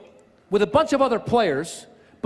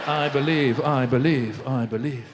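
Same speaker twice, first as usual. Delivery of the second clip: sinking refrain; 6 semitones lower, slower than usual. Acted. Comic echoing the visitor's catchphrase to the crowd, low fading refrain. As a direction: let each repeat drop lower and die away.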